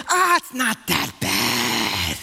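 low, slow, drawn-out brush-off; exaggerated dismissive drag on the words. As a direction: imitate someone waving away another's pain. Acts out dismissing someone else's pain.

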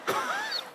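high, breathy laugh bursting out; sudden high laugh at the story; burst of laughter. Real, not acted. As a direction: laugh at the punchline. Laughing at dad's memory.

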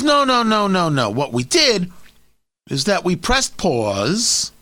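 put-on a light hard-edged New York accent, drawn out; lightly voiced New York governor, slow and insistent; Andrew Cuomo impression. Radio host mocks the governor's excuse, light New York accent. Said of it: "That's my, my Andrew Cuomo impression".

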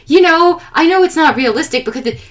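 high, animated imitation of dad; earnest mock pleading, springing up high at the end; enthusiastic dad. Dad pleading for unrealistic softball anime, high and eager. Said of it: "he was so enthusiastic about".

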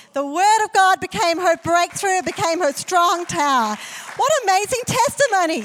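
loud, high, ringing preacher's declaration; full-force preaching. Acted. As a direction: proclaim it over the church at full power. Preaching climax about Wendy's healing.

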